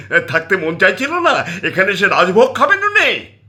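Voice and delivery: high, loud scolding rant; wife berating her husband for bringing his mother home, loud and furious; furious tirade. Acted. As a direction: tear into him over the money.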